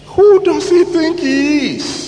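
high, outraged inner voice; high-pitched retold indignation; indignant anger. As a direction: fume at the pastor's nerve. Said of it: "I was angry".

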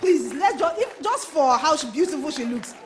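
loud, high-pitched demand for applause; rally the crowd to cheer her; rousing call. Real, not acted. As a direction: shout out excited praise to a congregation.

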